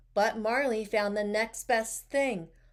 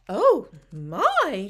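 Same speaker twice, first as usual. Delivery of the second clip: loud, swooping exclamation; loud mock dismay; teacher announcing; 8 dB louder than usual. A narrator's reading. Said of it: "she announced".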